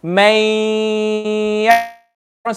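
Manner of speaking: MAY YA'MAL with a long nasal hum after MAY; with gunna. Shown as a demonstration. Teacher shows the class the difference, held nasal gunna. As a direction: hold may very long through the nose before ya'mal. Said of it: "pushing the sound through the nose".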